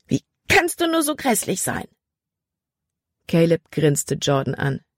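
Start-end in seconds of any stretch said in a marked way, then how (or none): 0.1-1.9 high, indignant outburst; explosive outburst. A narrator's reading. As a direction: burst out at him. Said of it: "explodierte dann".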